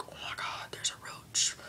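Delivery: hushed, hammed-up alarm; acted frightened whisper; mock panicked whisper. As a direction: whisper as if the roach might hear. Acting out spotting a roach.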